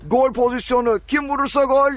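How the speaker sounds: goal call building from calm to a high, loud peak; screaming football commentator. Pretend. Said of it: "o heyecanı hissederek bir anons".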